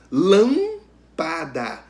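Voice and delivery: "Lâmpada" very slow, first syllable loud and long; teacher splitting "Lâmpada", strong first syllable; stress on LÂM. Shown as a demonstration. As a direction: punch "Lâm", then separate "pa" and "da". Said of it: "Ó".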